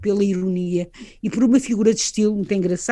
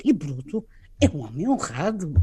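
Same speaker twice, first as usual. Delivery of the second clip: a lower, softer ironic read of "E Bruto é um homem honrado"; ironic intonation; 5 semitones lower, 5 dB quieter than usual. Shown as a demonstration. Presenter showing how actors make "honrado" ironic, lower and softer. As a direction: say "honrado" so it means the opposite. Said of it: "através da entoação".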